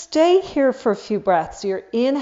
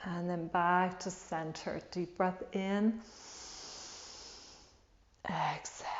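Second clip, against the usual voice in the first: guided meditation; 3 semitones lower, 7 dB quieter than usual. Acted. Leads a guided breathing meditation.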